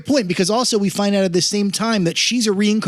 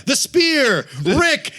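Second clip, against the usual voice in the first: high-pitched calls, only half shouted; mock yelling; 9 semitones higher, much slower than usual. Co-host mocking characters yelling into the air, hammed-up calls. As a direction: imitate movie characters crying out names. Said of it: "just yelling words".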